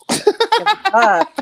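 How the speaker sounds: drawn-out give a fuck. Real, not acted. Defiant aside, slowed and high.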